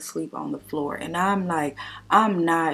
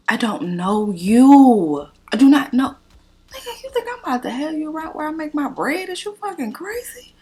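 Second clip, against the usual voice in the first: outraged shout; 9 semitones higher, 7 dB louder than usual. Real, not acted. Venting to viewers about the man, a loud high outraged rant. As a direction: blow up in disbelief at him. Outraged at his party request.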